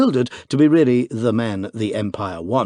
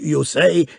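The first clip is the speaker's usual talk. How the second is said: you say, pushed out louder after the stutter; shocked host, recovering stammer; stammered restart; 6 semitones higher, 5 dB louder than usual. A narrator's reading. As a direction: force out you say. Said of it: "he stammered".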